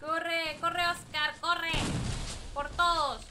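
loud, repeated urging; urgent shout. Real, not acted. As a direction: shout run over and over. Urging teammate to run.

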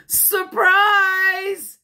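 high, loud, stretched-out yell; big ringing party yell; excited shout. A narrator's reading. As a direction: shout it to surprise the guest. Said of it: "shouted Amelia Bedelia".

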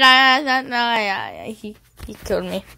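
usual speaking voice

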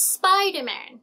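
Spider-Man, high start falling, no vowel after S; English SP cluster. Shown as a demonstration. Teacher models the name, high and crisp SP. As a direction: punch SPI in "Spider-Man", straight from S to P. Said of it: "เราจะไม่ออกเสียงอะ".

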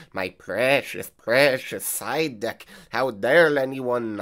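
Scottish Gollum voice. Retold or imitated. Mocking a secretive duelist hoarding his side deck, Scottish Gollum voice. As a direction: play a greedy Gollum with a Scottish brogue, drawing out precious. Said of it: "like, a Scottish accent trying to be, uh, Golem?".

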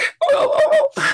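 high squeaky whale call; whale squeak. Pretend. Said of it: "Should I squeak?".

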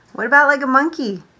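plain reading voice

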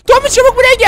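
high loud urgent plea; urgent call. Acted. After finding his sleeping photo, urgent loud call. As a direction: call him over fast.

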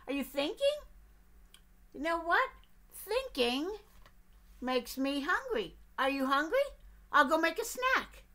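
high, loud, pushy little duck; eager duck. Acted. Duck pestering the bear with questions, high and loud. Duck's voice.

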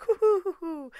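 high-pitched "hoo-hoo-hoo"; mock hooting. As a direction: imitate a gaggle hooting, high. Imitating girls' squeal, hooting.

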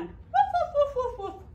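playful high puppy woofs; puppy barks. Acted. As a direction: yap like a little puppy. Puppy in counting rhyme barks.

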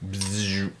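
buzzing drill sound with the lips; drill noise. Pretend. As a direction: mimic a power drill, bzzz. Imitating the drill while screwing.

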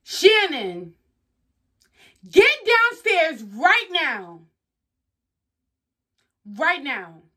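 loud, slow, high call downstairs; angry parent. Acted. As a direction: order the child down, dragging the words out loudly. Acted parent scolding a child.

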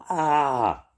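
high, loud startled yell; yelp as he slips. A narrator's reading. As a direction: cry out as you skid and fall. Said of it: "yelled Jake".

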